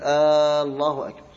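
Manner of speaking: آه، الله أكبر with a long stretched opening آه before الله; drawn-out hamza on Allah. Shown as a demonstration. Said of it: "هذا خطأ فادح". (مد همزة الله خطأً: آه، الله أكبر)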